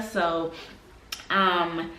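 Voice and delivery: ordinary talk, nothing marked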